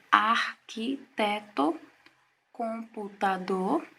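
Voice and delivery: "arquiteto" and "computador" stretched slowly, each syllable drawn out; slowly spelled out. Shown as a demonstration. Teacher sounds out "arquiteto" slowly so students count syllables. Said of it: "pronunciar estas palavras vagarosamente".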